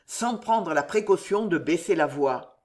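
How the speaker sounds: plain reading voice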